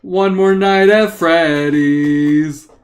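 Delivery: loud, extremely slow, sustained words; loud sing-song drawl; drawn-out refrain. Pretend. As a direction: stretch each word into a long held note. Echoes the refrain, stretched out.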